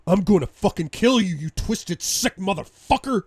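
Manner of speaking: loud, high, raging yell; furious threat. Acted. Husband beating his wife's attacker, furious threat. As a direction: roar the threat with full rage.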